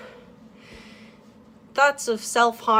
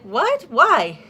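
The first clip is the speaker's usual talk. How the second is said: high "What?" then a loud "Why?"; shocked disbelief; 5 semitones higher, 6 dB louder than usual. Retold or imitated. Telling friends she just learned the insult, surprised. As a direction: react in sudden alarm. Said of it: "And I was like".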